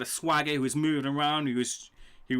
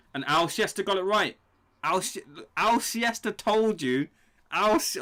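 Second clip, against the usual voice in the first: excited shout; 7 semitones higher, 5 dB louder than usual. Real, not acted. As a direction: cry out the name in triumph. Excited shout about prediction.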